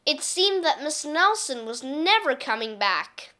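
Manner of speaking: plain reading voice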